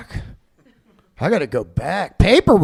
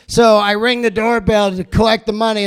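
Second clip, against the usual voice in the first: ordinary talk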